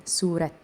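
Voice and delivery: سورة begun on a light s; sad as s. Shown as a demonstration. Teacher repeating the example, سورة with a plain s. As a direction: keep the s of سورة thin, as in seen. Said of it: "الصاد هنا نطقناها سين".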